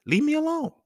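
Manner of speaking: high whining plea to be left alone; leave-me-alone whine, overplayed; mock whiny kid. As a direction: squeak it out like an annoyed child. Imitating kid self.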